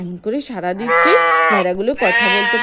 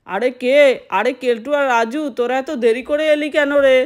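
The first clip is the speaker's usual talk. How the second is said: plain reading voice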